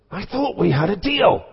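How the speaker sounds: an indignant groom complaining under his breath; hissed. Acted. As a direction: groom cornering the vicar after the wedding, mock hissing.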